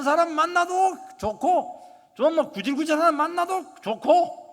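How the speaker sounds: loud, high, ringing sermon delivery; preaching at full force. Acted. Pastor urging the congregation to open their hearts, preaching loudly. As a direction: proclaim it to the whole hall.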